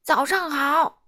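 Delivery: high, drawn-out holler; shouting postman. A narrator's reading. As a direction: call out a greeting at the door. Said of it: "邮递员大喊". (邮递员高声大喊)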